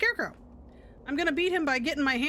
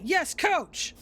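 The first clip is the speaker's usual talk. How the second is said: eager shout; 4 semitones higher than usual. Acted. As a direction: snap back like a pumped player. Mower answers coach firmly.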